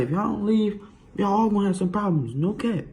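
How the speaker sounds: high-pitched mock warning; put-on high voice of an angry mom; threatening mom. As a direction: imitate a fed-up mother laying down a threat. Mom's threat to the cops acted out.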